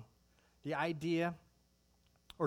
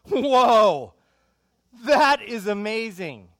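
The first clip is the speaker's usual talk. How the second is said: booming, drawn-out wow at the new movie; loud amazed whoa; 7 semitones higher, 18 dB louder, much slower than usual. Retold or imitated. Preacher to congregation, on seeing new Star Wars: loud awed shout. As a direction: shout your amazement, slow on amazing. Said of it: "You see that movie and you go".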